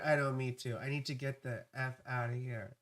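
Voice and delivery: ordinary talk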